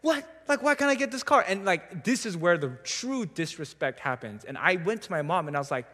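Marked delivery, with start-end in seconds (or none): 0.0-1.4 put-on upset teen. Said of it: "I remember being so upset".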